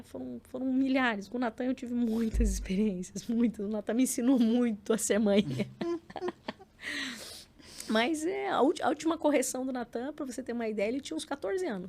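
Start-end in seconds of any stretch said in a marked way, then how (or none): 5.5-7.8 a breathy little laugh. Real, not acted. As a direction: laugh it off lightly. Laughs at own remark.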